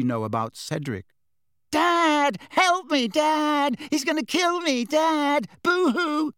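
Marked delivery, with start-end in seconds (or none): 1.7-6.3 very high mocking cry for help; mock boo-hooing, pitched way up; high-pitched whimpering. A narrator's reading. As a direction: mimic a whimpering child calling for Dad. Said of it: "adopted a high-pitched, whimpering voice".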